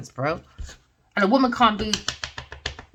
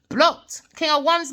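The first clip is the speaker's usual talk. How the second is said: ordinary talk, nothing marked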